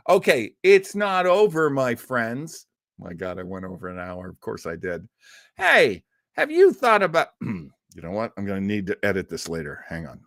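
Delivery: ordinary delivery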